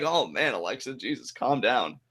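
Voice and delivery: soft, taken-aback murmur; muttered aside. Retold or imitated. Host recalling his reaction to wrestler's tantrum, hushed muttering. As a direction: mutter it under your breath, voice dropping away. Own quoted reaction to her outburst.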